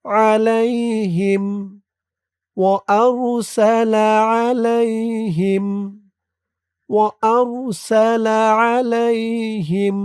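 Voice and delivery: slow, drawn-out chanted recitation; Quran recitation. Shown as a demonstration. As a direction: recite the verse slowly and melodically. Recites verse slowly. (ধীরে সুরেলা কুরআন তিলাওয়াত)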